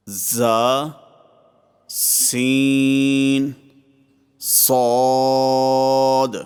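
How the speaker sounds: hissed, whistly first consonant, then a long level vowel; drawn-out whistling letters; whistling sibilants. Shown as a demonstration. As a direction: let the z and s whistle, then hold. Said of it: "سیٹی کی آواز کے ساتھ".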